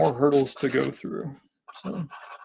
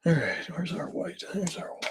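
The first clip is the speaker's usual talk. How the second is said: whispering; 4 semitones higher, 7 dB quieter than usual. Real, not acted. Muttering to self while drawing.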